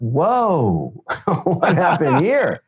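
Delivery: bewildered whoa with a laugh, slow; stunned whoa breaking into a laugh; startled. Retold or imitated. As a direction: caught off guard, half laughing. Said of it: "And I thought".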